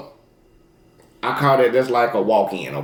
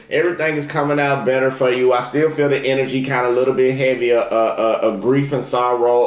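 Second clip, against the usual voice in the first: normal talking voice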